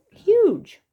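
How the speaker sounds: high, loud mock awe; exaggerated amazement. Real, not acted. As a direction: gasp it out like it's enormous. Marvels at giant apple.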